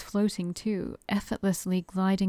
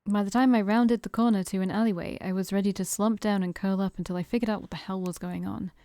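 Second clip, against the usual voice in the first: ordinary delivery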